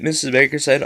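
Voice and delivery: ordinary delivery, nothing marked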